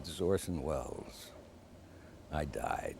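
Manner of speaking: low velvet movie-legend voice; impression of a famous director's deep voice; Orson Welles voice. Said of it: "In this deep, rich voice".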